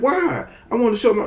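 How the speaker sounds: put-on high, pleading little boy; whiny kid voice. Little boy begging his mom, high whiny kid voice.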